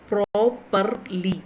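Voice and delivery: properly drawn out slowly in separate syllables. Shown as a demonstration. Teacher showing word stress to students, syllable by syllable. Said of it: "this is the word stress".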